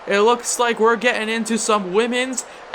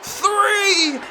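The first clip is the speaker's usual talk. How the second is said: each count higher, up to a shout on three; building count; 7 semitones higher, much slower than usual. Acted. Commentator counting the title-winning pin, building to a shout.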